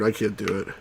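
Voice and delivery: ordinary delivery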